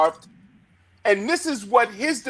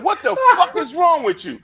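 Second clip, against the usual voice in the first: retold angry supervisor; 9 semitones higher than usual. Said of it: "He said".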